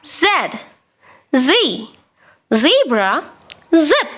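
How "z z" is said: ordinary talk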